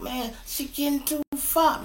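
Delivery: very high, alarmed protest; retold arguing. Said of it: "Me and him is in an argument".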